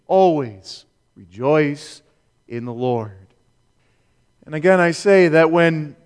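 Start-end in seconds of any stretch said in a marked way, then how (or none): 0.1-3.3 slow and lowered, sinking away at the end; measured, settled declaration, dropping low; slow, deliberate emphasis. Real, not acted. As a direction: lay each word down slowly as the final answer. Driving home no qualifications.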